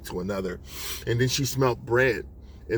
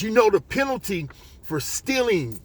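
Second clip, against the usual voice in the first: higher, angry accusation; upset store owner; 9 semitones higher, 4 dB louder than usual. Acted. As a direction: confront a thief, pitch raised. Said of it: "and he's still upset".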